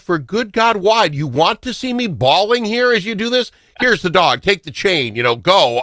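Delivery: high-pitched retold protest; exasperated refusal. Owner retelling his answer to being in the room, exasperated.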